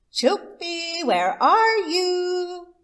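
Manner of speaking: high, drawn-out call across the yard. Acted. Chula Fox calling for missing Rabbit, long high call. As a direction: call him from far away, slow and high.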